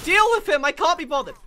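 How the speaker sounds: loud, high yell to teammates; exasperated shout. Real, not acted. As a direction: shout it off in a huff. Yelling at teammates mid-fight.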